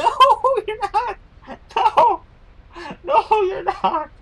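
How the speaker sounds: very high, loud laughing denial; playful squeaky no you're not; teasing squeal. Real, not acted. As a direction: tease a friend through giggles.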